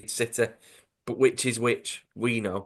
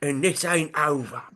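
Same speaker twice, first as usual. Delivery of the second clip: put-on comic thug voice, higher pitched; Charlie; 4 semitones higher than usual. Host repeats a threat on request, in the thug voice. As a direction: menace it in the thug's voice. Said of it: "in the same voice, just say".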